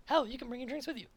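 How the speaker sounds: very high, quick little cat creature voice; high squeaky cat voice. Acted. GM voicing the little cat guide pointing out the boss, squeaky high. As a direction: squeak it out like a tiny excitable cat. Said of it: "And he goes".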